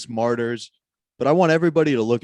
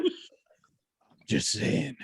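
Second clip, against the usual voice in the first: whispered aside; 9 semitones lower, 5 dB quieter, much slower than usual. Real, not acted. Defensive muttering aside.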